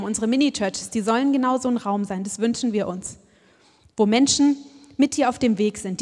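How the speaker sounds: normal talking voice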